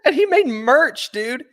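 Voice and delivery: high, slow, through laughing tears; laughing crying. Real, not acted. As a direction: wail it between laughs.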